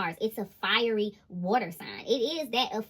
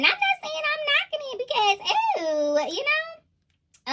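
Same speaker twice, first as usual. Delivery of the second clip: high teasing aside; 5 dB louder, slower than usual. Real, not acted.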